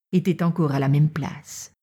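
ordinary delivery, nothing marked

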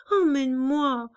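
tearful cry; crying plea. A narrator's reading. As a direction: let the second plea break into tears.

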